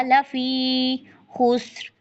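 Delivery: खुस्र with a rasping kha; rough kha. Shown as a demonstration. Teacher showing the friction of kha. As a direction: scrape the throat on the kh of खुस्र. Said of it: "ख्वा में रगड़ आएगी".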